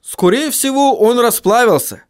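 high dwarf character voice; high-pitched dwarf observation; remarking dwarf. A narrator's reading. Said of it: "заметил Баддони".

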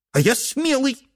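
high squeaky little voice. Acted. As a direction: squeak it like a tiny boy. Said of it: "пискнул Дрожащий Хвост".

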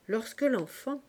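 ordinary delivery

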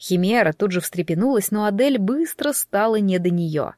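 ordinary delivery, nothing marked

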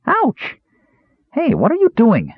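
high startled yelp of pain; cried in pain. A narrator's reading. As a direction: yelp as wine stings the eyes. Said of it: "Earwig cried in pain".

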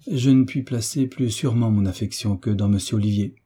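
ordinary delivery

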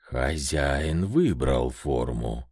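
a gentler grind of rock; grinding stone statue. Acted. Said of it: "Каменный голос стал мягче, тише".

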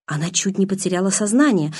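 ordinary delivery

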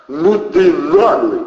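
loud, high, drawn-out exclamation; admiring outburst. A narrator's reading. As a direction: marvel loudly at someone's cheek. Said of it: "восхитился Протасов". (восхищённо, громко и протяжно)

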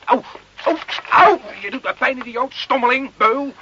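high yelps of pain, then insults; pained cries. Acted. Carlo yelps in pain as the sergeant searches him. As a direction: cry out as they grab the arm.

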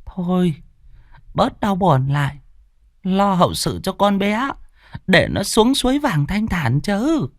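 put-on high, slow old woman consoling a grieving mother. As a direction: pat her shoulder and soothe her. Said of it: "vỗ lên vai an ủi".

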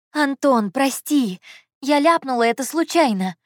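high, urgent excuse; pleading apology. A narrator's reading. Heroine apologises to Anton for her slip, pleading.

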